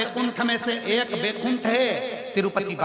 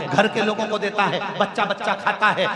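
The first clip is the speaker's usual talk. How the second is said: usual speaking voice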